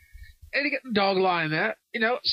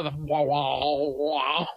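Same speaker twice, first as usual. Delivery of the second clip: mock didgeridoo drone; 5 semitones lower, much slower than usual. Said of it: "natives that play didgeridoos".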